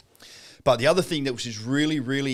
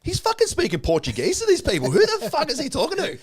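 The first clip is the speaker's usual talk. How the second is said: mock disbelief. Mock disbelief at Portuguese call.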